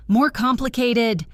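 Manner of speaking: enraged echo of his excuse; screaming. A narrator's reading. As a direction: throw his words back at him, screaming. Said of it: "She was screaming now".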